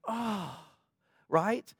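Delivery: a hushed, yearning plea; gentle Jesus. Retold or imitated. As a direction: voice Jesus tenderly as if aching for him. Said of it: "I think Jesus was just like".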